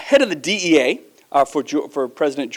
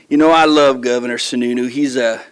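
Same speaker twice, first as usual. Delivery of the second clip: put-on Georgia accent; 2 semitones lower, 4 dB louder than usual. Governor imitating a fellow governor on a panel, Georgia accent. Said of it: "in that Georgia accent".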